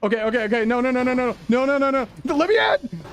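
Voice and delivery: high, rushed, frightened pleading; panicked pleading. Real, not acted. Streamer locked out by aliens, pleading in panic. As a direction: beg to be let in, terrified.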